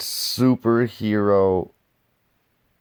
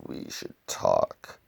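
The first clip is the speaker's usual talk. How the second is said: slow, much lower hushed whisper; 6 dB quieter, slower than usual. Retold or imitated. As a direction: breathe it like a secret threat. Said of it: "she says".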